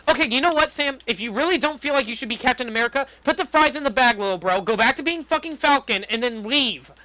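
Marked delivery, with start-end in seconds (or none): none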